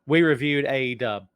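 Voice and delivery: normal talking voice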